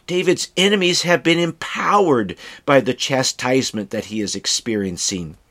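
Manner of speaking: ordinary talk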